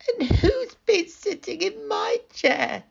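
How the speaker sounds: put-on very high little baby bear; small, high-pitched Baby Bear; tiny squeaky voice. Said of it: "squeaked Baby Bear".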